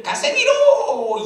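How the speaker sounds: very high, loud drawn-out yell; hammed-up battlefield holler, held long; shouted command. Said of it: "경상도 출신의 소대장이 소리를 쳤습니다".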